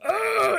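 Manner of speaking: high, loud war cry as Drax; battle scream. Retold or imitated. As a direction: shriek a war cry mid-leap. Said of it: "he's doing the super stab".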